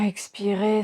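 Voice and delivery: ordinary delivery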